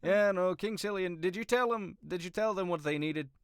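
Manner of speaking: high, animated in-character query; Gigi questioning. Acted. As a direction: press the question in a lifted voice. GM voices Gigi questioning king.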